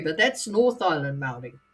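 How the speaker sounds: ordinary delivery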